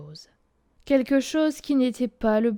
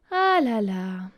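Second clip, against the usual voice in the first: grumbling; 2 semitones lower, slower than usual. A narrator's reading. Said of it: "grogna-t-elle".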